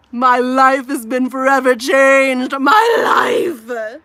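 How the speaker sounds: loud, slow, dramatic proclamation; overwhelmed awe. Retold or imitated. Twelve-year-old self after Wicked, loud dramatic awe. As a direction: declare it like your life just changed. Retells being moved by Wicked.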